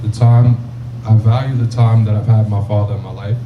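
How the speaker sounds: ordinary talk, nothing marked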